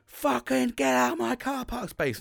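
high, drawn-out mock yell; screechy-high retold outburst; imitated screaming. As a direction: imitate an irate woman screaming, slowly. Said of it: "she's fucking screaming at me".

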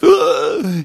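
sharp, loud gasp; sudden high intake of breath; gasp of realization. Acted. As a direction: gasp for air in alarm. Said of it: "that's when he realized".

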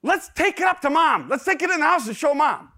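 put-on high, loud, eager child voice; excited kids. Sons proposing to show Mom the turtle, excited. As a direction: pitch it up like boys with a plan. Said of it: "The first thing they say is".

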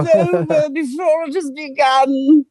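very high, drawn-out hammed-up wail; mock despair. Actor retelling being dropped by his agency, melodramatic mock wail. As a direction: wail it like a tragic cartoon character, squealing.

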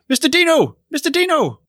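high-pitched, put-on hobbit call; Sam. Co-host mimics Sam addressing his friend, high put-on voice. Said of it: "I'm doing a Sam voice".

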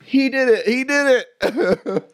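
high-pitched, drawn-out accusation; high, hammed-up tattletale cry; mock frantic tattling. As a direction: imitate kids racing to tell on each other. Rivals tattling at police station.

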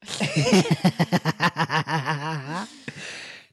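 helpless staccato giggling, rising at the end; drawn-out giggling fit; long real laugh. Real, not acted. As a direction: crack up in one long run of laughter. Cracking up at the gibberish attempt.

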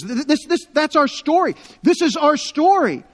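high and loud, words tumbling out in wonder; a refugee gasping that this is her own story; excited recognition. Retold or imitated. Refugee telling the visitor, bursting with excited recognition.

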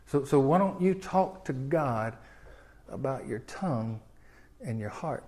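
hushed pastoral appeal; gentle plea. Acted. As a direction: lean in and plead quietly. Softens to an intimate plea.

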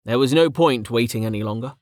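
plain reading voice

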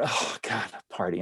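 retold resigned complaint; weary dismay. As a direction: grumble it quietly to yourself, deflated. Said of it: "we're going".

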